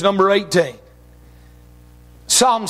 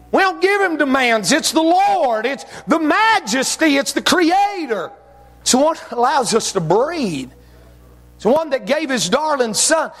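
fervent preaching; 5 semitones higher than usual. Real, not acted. Preaching at full force.

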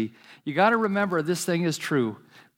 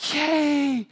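put-on high squeaky child's "Yay!"; little boy shouting yay; excited kid cheer; 11 semitones higher than usual. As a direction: cheer like a thrilled five-year-old. Little boy cheering third time.

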